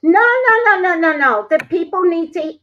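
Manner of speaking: loud, high-pitched scolding; scolding the cat. Real, not acted. Woman scolding her cat on stream, loud and high. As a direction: tell the cat off sharply.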